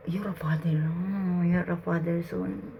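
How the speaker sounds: breathy whisper, no voice at all. Real, not acted. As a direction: whisper it as if awed. Hushed moment at the spirit box.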